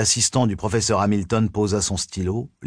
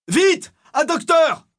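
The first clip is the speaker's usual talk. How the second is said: very high, drawn-out cry for help; yelling; 4 dB louder, slower than usual. A narrator's reading. Assistant calls for a doctor, in a high panicked yell. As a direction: yell for help in two separate bursts. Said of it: "hurla l'assistant".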